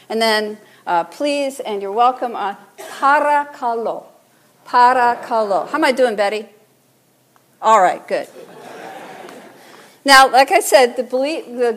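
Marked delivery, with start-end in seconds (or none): 2.8-5.7 "parakalo" in careful pieces, para, ka-LO; teaching Greek pronunciation. Shown as a demonstration. Preacher teaching congregation "parakalo", careful para-ka-LO. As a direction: say "parakalo" deliberately for others to repeat. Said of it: "Let's try those words".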